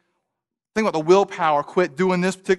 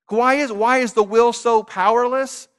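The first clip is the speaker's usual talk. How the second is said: ordinary talk, nothing marked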